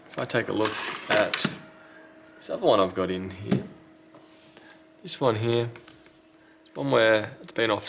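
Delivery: usual speaking voice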